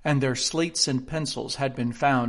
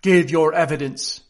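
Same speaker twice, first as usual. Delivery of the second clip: firm, raised command; 2 semitones higher, 7 dB louder than usual. A narrator's reading. King ordering the witness to testify, commanding. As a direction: order it loudly.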